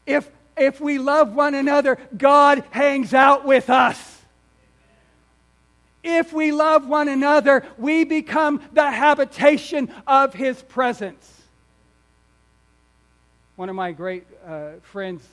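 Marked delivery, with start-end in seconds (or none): 0.0-11.3 loud, higher preacher's proclamation; forceful emphasis. Shown as a demonstration. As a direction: drive each phrase home at raised pitch and volume. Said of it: "Can you put an emphasis on that word for a moment?".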